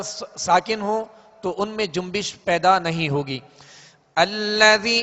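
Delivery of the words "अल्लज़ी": 1 recited word. Shown as a demonstration. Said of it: "Quran recitation demo".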